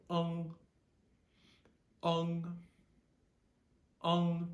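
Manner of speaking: UNG held high and level, rhyming with "lung"; first tone. Shown as a demonstration. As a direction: say UNG loud on one high, steady note. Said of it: "we're staying with first tone".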